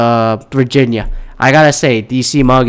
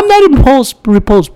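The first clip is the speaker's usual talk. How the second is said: mock high voice; 11 semitones higher, 5 dB louder than usual. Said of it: "people are like".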